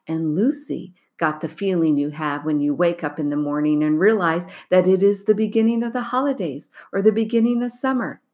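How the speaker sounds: ordinary delivery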